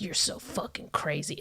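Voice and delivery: hushed, low-key imitation of an accuser; imitated quiet accusation. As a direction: say it very softly, as the boyfriend would. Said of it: "he would get quiet".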